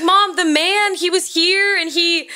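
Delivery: put-on pleading child. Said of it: "I was like".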